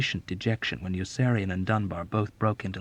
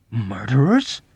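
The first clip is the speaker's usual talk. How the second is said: affronted; 2 semitones higher, 8 dB louder, slower than usual. Acted. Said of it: "Dobbs was affronted".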